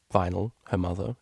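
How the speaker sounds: usual speaking voice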